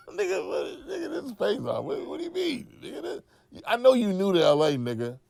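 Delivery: can't believe what he just heard; incredulous outburst. Retold or imitated. Incredulous reply to Cat.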